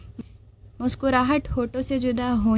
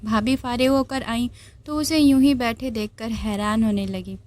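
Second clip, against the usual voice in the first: ordinary delivery, nothing marked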